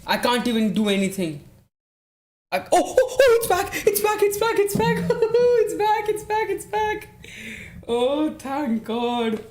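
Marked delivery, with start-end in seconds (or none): 2.5-7.1 very high, rapid-fire excited squealing; elated. Real, not acted. Streamer as the lost match feed returns, elated. 7.9-9.4 high, long drawn-out relief; relieved. Real, not acted. Streamer thanking God the feed is back, relieved.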